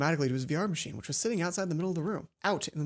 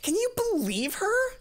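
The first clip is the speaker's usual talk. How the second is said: much higher, slow indignant cry; Mai outraged; 4 dB louder, slower than usual. Acted. Mai fuming to Hana about the librarian, high. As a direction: disbelief at the injustice.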